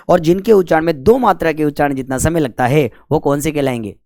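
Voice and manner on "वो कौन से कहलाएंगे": ordinary talk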